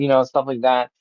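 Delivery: ordinary talk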